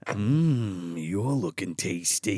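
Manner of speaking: low, slow, savouring purr; hungry mother. Retold or imitated. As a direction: imitate a mother eyeing her son as food, low and drawn out. Mother hungrily eyes her son.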